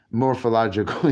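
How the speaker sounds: ordinary delivery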